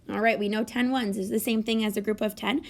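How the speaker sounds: ordinary talk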